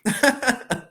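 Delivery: short burst of high, loud laughter; real laugh. Real, not acted. Host laughs at his own joke about the Marseille accent, real laughter.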